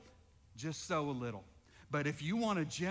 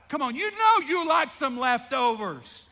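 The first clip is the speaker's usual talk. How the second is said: high and much louder; preacher shout; 6 semitones higher, 10 dB louder than usual. Real, not acted. Pastor shouting to the congregation, loud and high. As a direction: call it out to the room.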